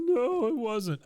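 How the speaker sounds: put-on high, loud whiny boy; mock sobbing. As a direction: wail the answer, hurt and hammed up. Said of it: "sobbing Bobby come in".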